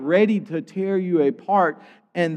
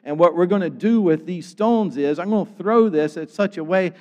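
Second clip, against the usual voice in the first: ordinary talk, nothing marked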